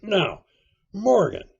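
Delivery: loud, high stern summons. A narrator's reading. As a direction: call him to account. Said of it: "very sternly".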